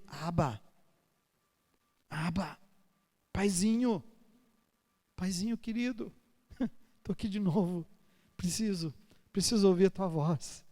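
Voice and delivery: soft, intimate call to father; whisper-close, like a child to dad; hushed loving prayer with a small laugh; tender prayer. Pretend. Praying tenderly to God.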